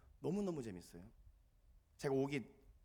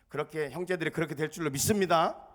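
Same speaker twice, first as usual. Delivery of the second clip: louder, ending on a rise; louder call for amen; 12 dB louder than usual. Shown as a demonstration. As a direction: push it out to draw an amen. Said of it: "다시 해봐야지".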